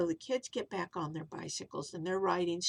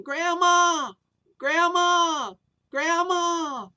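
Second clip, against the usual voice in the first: long high falling calls, much louder; calling out; 9 semitones higher, 10 dB louder, much slower than usual. Acted. Kids calling for lost grandma, loud long calls. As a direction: shout her name down the street, searching.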